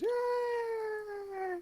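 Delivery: thin, very high long cheer; cheering crowd. Acted. Game master voicing audience reaction to prize, mock cheer. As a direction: imitate a whole crowd with one soft squeal. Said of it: "And everyone goes".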